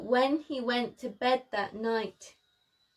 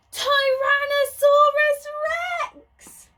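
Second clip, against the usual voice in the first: high, loud frightened cry; storyteller shrieks at the monster; terrified shout; 9 dB louder than usual. A narrator's reading. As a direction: scream the name in fright. Shock at seeing the T. rex.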